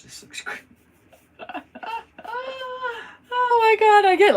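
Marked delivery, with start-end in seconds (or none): 1.4-3.3 a high-pitched squeal of a laugh. Real, not acted. Squealing laugh of a crafter delighted at her painting. 3.4-4.3 high, loud, thrilled oh my God; thrilled exclaim. Real, not acted. Loud high delight as she sees the painting turn out.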